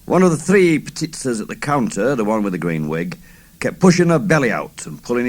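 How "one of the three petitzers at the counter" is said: plain reading voice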